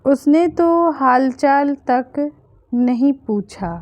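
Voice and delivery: usual speaking voice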